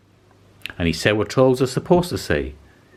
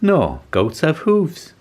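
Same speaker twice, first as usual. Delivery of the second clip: slow rabbit voice; 8 semitones higher, much slower than usual. Acted. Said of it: "said the rabbit".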